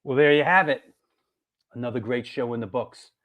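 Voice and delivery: normal talking voice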